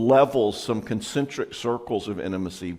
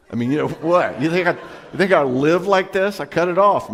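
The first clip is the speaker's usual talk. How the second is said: mock indignation; 5 semitones higher, 5 dB louder than usual. Mock indignant reply at party.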